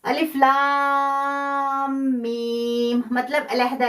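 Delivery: अलिफ लाम मीम, each letter cut apart and drawn out slowly; letters read separately. Shown as a demonstration. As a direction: say अलिफ, लाम, मीम one by one with clear breaks. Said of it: "काट के पढ़ेंगे".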